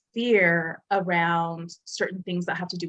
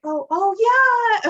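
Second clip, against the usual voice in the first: slow, drawn-out, much higher oh yeah; sheepish realization; 9 dB louder, much slower than usual. Retold or imitated. Caught lying about heights, a slow sheepish oh yeah. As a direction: let it dawn on you, caught out. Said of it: "And I was like".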